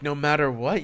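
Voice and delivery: usual speaking voice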